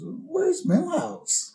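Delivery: small, high, drawn-out question; soft, plaintive kid voice, very slow; Bart. Retold or imitated. Bart asking the hazmat man, high soft worry. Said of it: "Bart goes".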